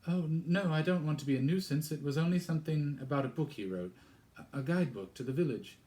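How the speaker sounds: high, loud and fast; flustered young girl. Acted. Girl nervously explains to strange vicar, high and hurried. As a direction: rush it out, high and anxious.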